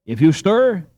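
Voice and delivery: loud, high menacing threat; terrible threat. A narrator's reading. Boy pinning a robber, threatening in a terrible voice. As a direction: make it as fierce as he can. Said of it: "in as terrible a voice as he could command".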